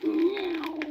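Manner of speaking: very high, drawn-out cat meow; wailing meow. A narrator's reading. Sad old cat meowing to the donkey, very high. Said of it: "wailed the cat".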